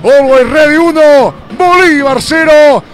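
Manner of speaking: high, slow emphatic goal recap; breakneck goal commentary. Real, not acted. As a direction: hammer out the scoreline. Goal recap, raised pitch.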